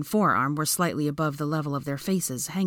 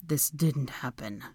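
slow, softer denial; pleading; 3 semitones lower, 5 dB quieter, slower than usual. A narrator's reading. Draco catching his breath after fighting, pleading. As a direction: plead quietly and slowly. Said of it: "he said pleadingly".

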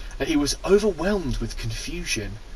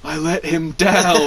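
mock tragic Leia. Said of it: "your first thought wouldn't be".

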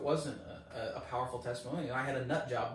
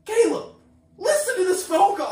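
high, loud shout for attention; urgent calling out; 12 dB louder than usual. Retold or imitated. Calling a supervisor to hear the call, urgent shout. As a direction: holler across to a coworker. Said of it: "trying to get his attention".

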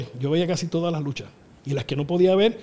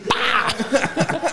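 high, explosive "pah" of impact; imitated punch noise; 9 semitones higher than usual. Telling how he punched the cut, explosive impact noise. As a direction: punch sound effect with a laugh behind it.

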